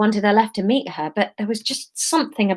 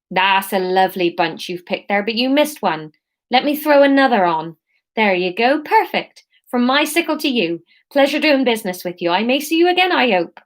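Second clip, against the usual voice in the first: put-on Somerset accent; 2 semitones higher than usual. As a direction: keep the Somerset burr going. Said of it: "this is gonna be Somerset".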